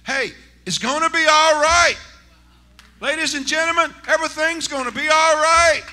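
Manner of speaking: loud, high, shouted preaching; hollering gospel preacher in full cry; preaching at full force. Acted. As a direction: lift the whole room with a roaring promise.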